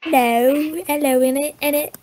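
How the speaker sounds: high-pitched mock British accent. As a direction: put on a cartoonish British voice. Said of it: "What does everyone's British accent sound like?".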